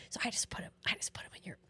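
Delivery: retold secretive whisper. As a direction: hush it like a secret tip. Manager sneaks her extra fries.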